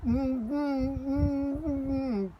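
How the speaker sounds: high muffled mms through a mouthful; boy trying to answer through chocolate; murmuring with mouth full. Acted. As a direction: hum it with cheeks stuffed with chocolate. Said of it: "it came out as".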